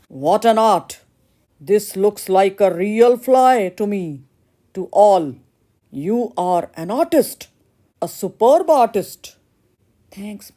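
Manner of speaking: put-on loud, grand praise from the old painter; booming proud master. Painter father loudly praises the blacksmith and grants the marriage. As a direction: proclaim it like a delighted master.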